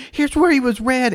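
high-pitched little girl impression; acted kid voice, high; Alex. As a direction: imitate an earnest child up high. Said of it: "She goes".

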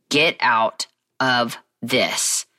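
very slow, low, deliberate warning; gut warning. Retold or imitated. Her gut to her during the marriage, deliberate warning. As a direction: space out each word as an inner warning.

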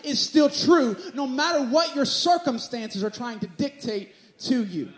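rising, loud preacher cadence; preaching at full force. Acted. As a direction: preach it with building fire. Preaching builds over the promises.

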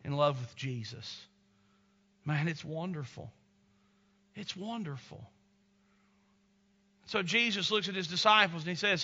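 ordinary talk, nothing marked

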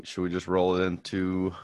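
ordinary delivery

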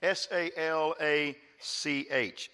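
slow spelling of salach, each letter apart; spelled out letter by letter. Shown as a demonstration. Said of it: "the Hebrew word for prosperity is the word salach".

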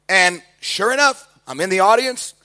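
normal talking voice

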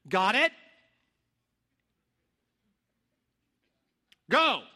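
loud coach's go; coach shout. Retold or imitated. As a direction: bark it out to launch the team. Coach sends team out.

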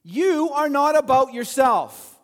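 preacher hammering the point home; emphatic. Real, not acted. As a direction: land every word hard.

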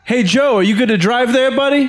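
calling out. Acted. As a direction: call across to a drunk buddy. Calling to a drunk friend.

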